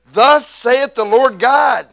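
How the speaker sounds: loud, slow proclamation; preaching. Acted. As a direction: land the Lord's name with weight. Scripture climax.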